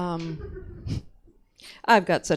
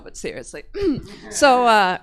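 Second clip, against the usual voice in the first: ordinary talk, nothing marked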